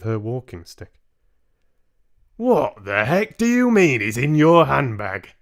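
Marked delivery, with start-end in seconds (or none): 2.4-5.3 louder, indignant shout; a hammed-up angry outburst; mock shout. Father indignant at the handbag claim, acted shout. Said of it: "Mister Jenkins shouted".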